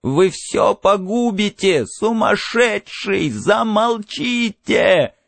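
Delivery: high, slow, drawn-out plea; urgent high-pitched scolding in the ear; Faber's piercing warning. A narrator's reading. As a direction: beg him to stop, stretching every word. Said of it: "голос Фабера ввинчивался Монтегу в ухо".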